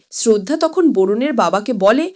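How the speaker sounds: usual speaking voice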